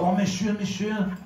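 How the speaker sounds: put-on French waiter accent. French waiter appears at the diner's table, mock French accent. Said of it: "this French accent".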